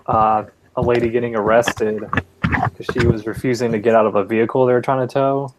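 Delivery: ordinary talk, nothing marked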